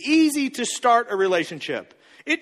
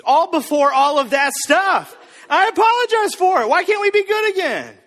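pleading outburst; 7 semitones higher, 5 dB louder than usual. Retold or imitated. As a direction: beg loudly to make up. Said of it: "We're going".

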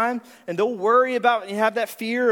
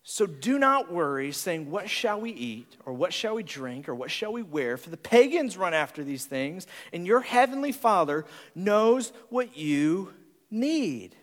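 scripture reading; 3 semitones lower than usual. Acted.

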